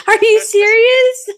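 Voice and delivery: high, loud, drawn-out incredulous question; laughing disbelief. Retold or imitated. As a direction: squeal it in amused disbelief. Retold disbelief at friend's remark.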